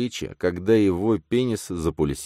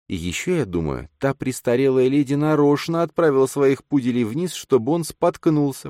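слегка выше, мягкий голос женщины; чуть озвученная молодая женщина; женский голос героини; 9 semitones higher than usual. A narrator's reading. Эллен озвучена выше голоса рассказчика. (лёгкий женский голос)

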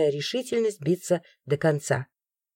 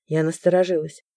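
ordinary delivery

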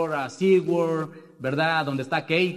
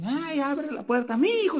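mother's voice; 11 semitones higher than usual. Retold or imitated. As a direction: voice his mother half asleep at the door. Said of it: "Me dice". (voz de madre anciana, mucho más aguda)

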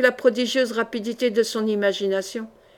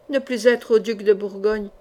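ordinary delivery

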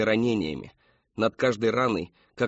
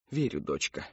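low, soft mother's voice; tender reassurance; 6 semitones lower, 6 dB quieter than usual. A narrator's reading. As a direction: reassure your daughter softly. Mother tenderly believes daughter.

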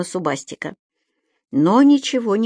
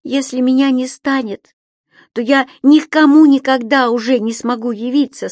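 earnest creature voice; 6 semitones higher, 5 dB louder than usual. A narrator's reading. Subastik insists.

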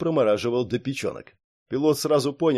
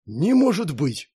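slow, higher-pitched disbelief; bewildered exhalation; 5 semitones higher, slower than usual. A narrator's reading. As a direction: breathe it out in bewilderment. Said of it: "выдохнул Громила с недоумением". (изумлённо выдохнуть, медленно и высоко)

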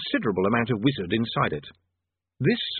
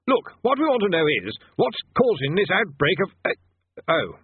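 high, nervous stammering wizard; flustered bursar; 7 semitones higher than usual. A narrator's reading. Bursar voiced.

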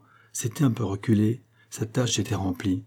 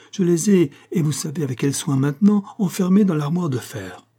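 high, louder insistence; agitated exclamation; 7 semitones higher, 3 dB louder than usual. A narrator's reading. Aide insisting the documents are locked away, agitated. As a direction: protest urgently, pitch held high. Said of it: "s'écria-t-il".

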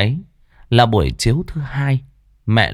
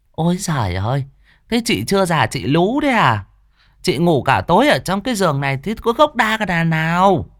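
put-on higher, fast nagging old mother's voice; scolding grandmother; 7 semitones higher than usual. Grandmother scolding her oversleeping daughter, higher and nagging. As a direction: nag like an exasperated old mother.

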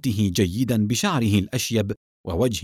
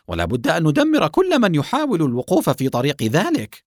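forceful order; 6 semitones higher, 3 dB louder than usual. Acted. Chief orders enemies destroyed.

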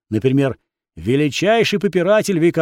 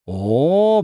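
drawn-out oh. A narrator's reading. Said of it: "протянула Моника".

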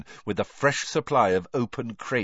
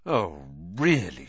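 spluttering; 4 semitones lower, slower than usual. A narrator's reading. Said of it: "she spluttered".

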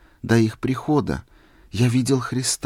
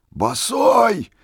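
much higher, loud outburst; rapturous shout; 11 semitones higher, 4 dB louder than usual. A narrator's reading. Old man shouting in delight at barefoot Misha. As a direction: burst out in delight. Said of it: "восторженно закричал старик".

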